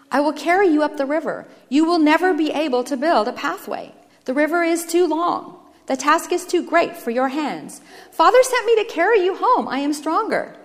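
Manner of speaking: rescuer's insistent appeal; earnest firstborn. Acted. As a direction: urge him to let you help. Firstborn urges brother to accept.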